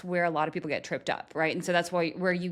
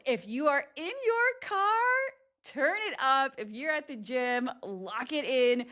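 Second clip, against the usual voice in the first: excited announcer hype; 6 semitones higher than usual. Real, not acted. Hyping listeners for segment.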